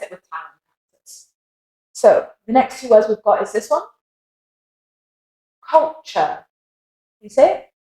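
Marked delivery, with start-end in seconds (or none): 5.7-6.4 British Culture ending in "chuh"; British accent. Shown as a demonstration. Teacher modelling Culture for learners, British accent. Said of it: "And then an American accent".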